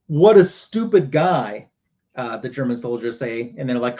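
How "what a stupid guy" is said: slow, higher and louder mock scorn; retold jeer, drawn out and raised; lightly acted contempt; scornful quote. Soldiers mocking the knocked-out man.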